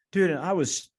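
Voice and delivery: ordinary talk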